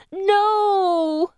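high, long-drawn wail. A narrator's reading. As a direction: wail the refusal in terror. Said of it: "Anne wails".

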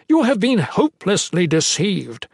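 high, urgent appeal; pleading. A narrator's reading. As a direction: press the point desperately. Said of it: "Ethris pleaded".

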